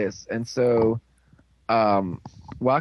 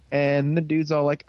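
ordinary talk, nothing marked

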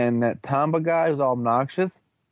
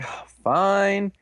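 mock reluctant agreement; 7 semitones higher than usual. Giving in reluctantly to the plea.

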